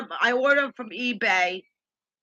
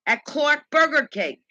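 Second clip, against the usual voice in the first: usual speaking voice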